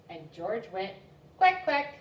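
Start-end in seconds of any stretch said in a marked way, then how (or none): 1.4-2.0 high, loud quacks; duck quack. Acted. Puppy George barking wrong, loud high quack.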